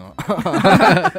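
a rising bright laugh that breaks out; burst of laughter. Real, not acted. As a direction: laugh out loud at the punchline. Host bursts out laughing at story. (忍不住笑出声)

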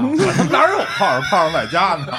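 high, loud, laughing protest; laughing shout. Real, not acted. Answering the firecracker joke with a laughing shout. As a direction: shout it through your laughter.

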